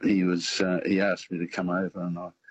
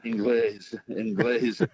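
Spanish "Inglés" repeated with a higher, singsong lilt; Spanish-accented teasing; 4 semitones higher, slower than usual. Retold or imitated. Spanish cleaners mocking his accent, singsong Spanish lilt. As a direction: imitate Spanish workers teasing the Englishman, slightly higher. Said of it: "they said".

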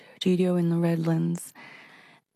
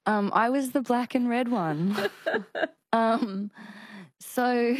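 giggling through the words, higher; laughing; 5 semitones higher than usual. Real, not acted. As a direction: laugh while telling it. Laughing at Powerpuff costume memory.

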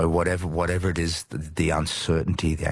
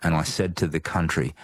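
normal talking voice

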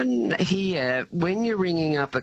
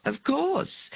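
usual speaking voice

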